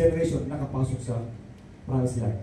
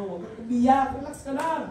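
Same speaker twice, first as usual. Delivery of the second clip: ordinary talk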